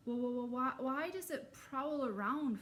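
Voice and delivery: scared camper stammering; nervous stutter. Acted. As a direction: stumble nervously over the start. Nervous kid stutters a question.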